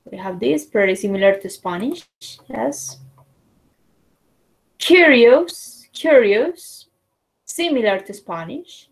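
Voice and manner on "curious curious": high, bright, lifting curiosity; curious. Shown as a demonstration. Teacher acting out curious for the class, lifted up. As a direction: ask it like you're intrigued.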